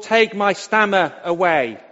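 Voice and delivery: ordinary delivery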